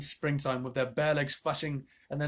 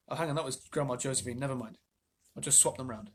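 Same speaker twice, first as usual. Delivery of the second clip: ordinary delivery, nothing marked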